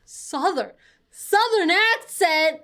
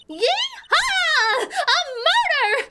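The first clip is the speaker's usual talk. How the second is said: gleeful whooping; 5 semitones higher, slower than usual. Acted. Southern reporter celebrating her big scoop, high gleeful hollering. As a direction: crow with glee, opening very high then easing.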